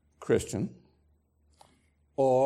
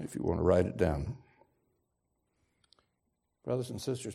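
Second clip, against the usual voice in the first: ordinary talk, nothing marked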